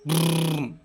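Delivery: put-on child's vroom; car sound. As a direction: rumble like a toy car. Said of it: "ഒന്നര വണ്ടിയാ?". (വണ്ടി ശബ്ദം)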